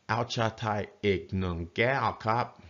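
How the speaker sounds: slow "Ao cha Thai ik nung gao kap", each tone distinct; careful Thai tones. Shown as a demonstration. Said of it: "flat tone, flat tone, flat tone, low tone".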